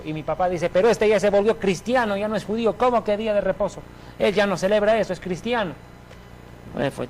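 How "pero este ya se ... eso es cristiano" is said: retold protest in a raised, higher pitch; indignant father. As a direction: object indignantly, as the father would. Retold father objecting to his son. (padre indignado que protesta)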